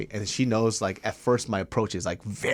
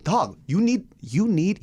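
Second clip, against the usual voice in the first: pitched-up, exasperated lecture; higher, incredulous telling-off; retold exasperated scolding; 8 semitones higher, slower than usual. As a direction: plead with him like he's being ridiculous. Retold scolding of angry caller.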